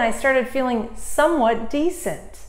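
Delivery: normal talking voice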